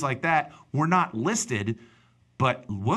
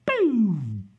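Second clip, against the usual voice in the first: high, falling "boom" sound effect; imitated boom; 8 semitones higher, 4 dB louder than usual. Showing how he tunes someone out, high boom. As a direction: drop a big cartoon boom.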